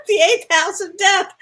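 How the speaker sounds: high, loud, cracking up; gleeful gallows humour; laughing mock doom. Real, not acted. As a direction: say it through real laughter. Joking about chart, laughing.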